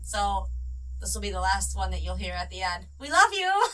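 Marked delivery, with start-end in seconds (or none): 3.0-3.7 high, loud cheerful shout; excited shout. Real, not acted. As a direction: shout it out happily. Excited sign-off to listeners.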